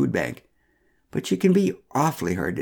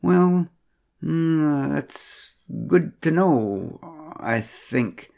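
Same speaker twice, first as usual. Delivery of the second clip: uncertain; 5 semitones higher, much slower than usual. Acted. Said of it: "said Joseph Glatt uncertainly".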